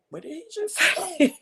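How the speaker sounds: high astonished gasp of a question. Retold or imitated. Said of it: "Of course, I went".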